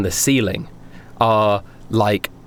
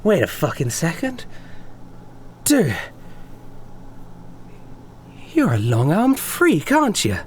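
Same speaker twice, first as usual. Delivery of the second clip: stunned realization; 6 semitones higher, 4 dB quieter than usual. Acted. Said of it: "And then he goes".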